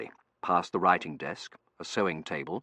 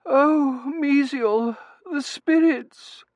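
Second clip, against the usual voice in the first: very high, drawn-out wail; high-pitched invalid's distress; swooning wife; 10 dB louder, much slower than usual. A narrator's reading. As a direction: moan it melodramatically to husband.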